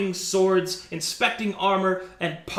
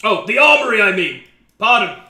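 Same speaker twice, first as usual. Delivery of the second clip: loud jolly self-correction; 3 semitones higher, 9 dB louder, faster than usual. Acted. Jolly officer corrects himself.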